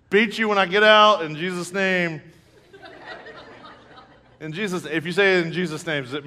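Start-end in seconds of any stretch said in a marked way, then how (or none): 0.1-2.2 mock fatherly threat. Acts dad threatening his children.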